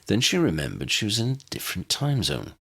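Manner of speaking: ordinary delivery, nothing marked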